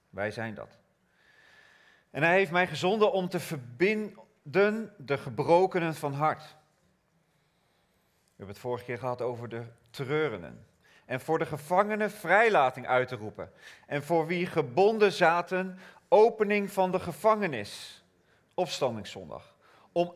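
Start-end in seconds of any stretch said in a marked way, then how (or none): 2.1-6.4 ringing scripture proclamation; preaching. Acted. Reads Isaiah proclaiming. 11.0-18.0 ringing scripture proclamation; preaching. Acted. Reads Isaiah proclaiming.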